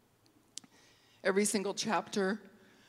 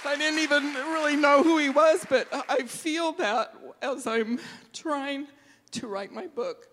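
tearful; 5 semitones higher, 4 dB louder than usual. Real, not acted. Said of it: "I get emotional".

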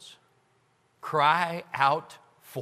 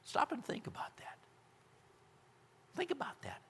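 hushed whisper inviting a pause; reflective whisper; 9 semitones higher, 13 dB quieter than usual. Acted. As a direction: whisper it so it sinks in. Preacher glosses Selah as pause.

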